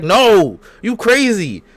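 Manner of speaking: high, loud retort; mock outraged refusal. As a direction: imitate an appalled friend shutting it down. Voicing friend refusing outright.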